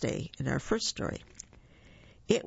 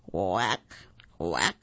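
low duck voice quacking; 9 semitones lower than usual. Acted.

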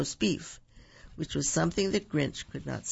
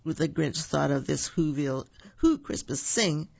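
usual speaking voice

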